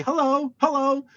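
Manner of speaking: high-pitched parrot greeting; talking parrot. Retold or imitated. Customer's parrot saying hello, high mimicking squawk. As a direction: chirp it high like a pet parrot. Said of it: "my customer's parrot, it'll say".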